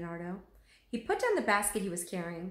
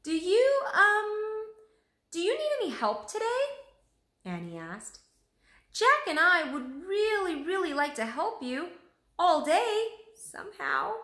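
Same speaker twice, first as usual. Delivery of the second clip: higher, halting eager plea; hopeful girl voice; 11 semitones higher, 5 dB louder than usual. A narrator's reading. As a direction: ask shyly but keenly. Annie nervously asking for work.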